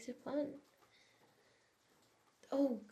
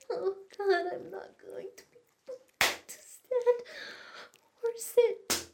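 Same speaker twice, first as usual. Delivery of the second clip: much higher and louder, begging; high, loud pleading cries; 8 semitones higher, 7 dB louder than usual. Acted. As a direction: cry out as it builds. Climax building, crying out.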